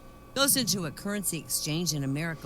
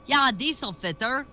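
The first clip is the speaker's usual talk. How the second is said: sarcastic retort; 8 semitones higher, 8 dB louder than usual. Retold or imitated. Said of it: "he says".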